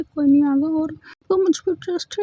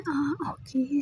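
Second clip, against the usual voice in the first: whispered agreement; barely voiced, intimate; breathy hushed okay; soft whisper; 9 dB quieter, much slower than usual. Intimate scene, softly agreeing.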